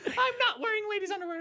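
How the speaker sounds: high puppet voice, laughing; Pinocchio voice. Retold or imitated. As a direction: insist in a squeaky puppet voice.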